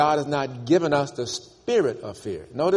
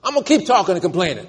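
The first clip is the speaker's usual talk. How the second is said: defiant Job; 8 semitones higher, 3 dB louder than usual. Retold or imitated. Job vowing to keep complaining, defiant and high. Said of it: "putting a stake in the ground".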